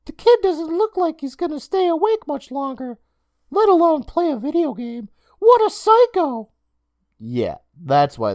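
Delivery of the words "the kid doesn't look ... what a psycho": put-on high boyish voice, appalled; boy's inner thought. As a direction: think it in a high kid voice, slowing on psycho. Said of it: "He thought to himself".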